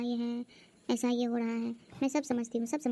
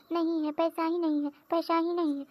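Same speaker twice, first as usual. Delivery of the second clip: higher, louder mock whine of an excuse; mocking imitation; 6 semitones higher, 3 dB louder than usual. Sister-in-law mimicking bhabhi refusing her money, higher mocking whine. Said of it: "मैं कुछ मांगती हूँ तो बोलती".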